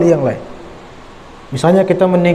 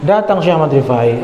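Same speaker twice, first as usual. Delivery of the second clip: ordinary talk, nothing marked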